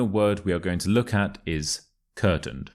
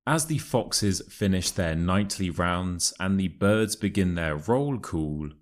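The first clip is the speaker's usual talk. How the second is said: model reading. Shown as a demonstration. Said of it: "listen to the passage and repeat after me".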